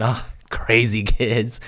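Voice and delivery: lightly voiced dismissive dad; a hint of an amused dad. Imagining dad's thoughts.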